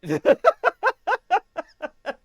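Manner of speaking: a big burst of laughter, pitched high; loud high laugh. Real, not acted. Streamer after a bouncy plane landing, loud high laugh. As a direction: let out a loud, high cackle of delight.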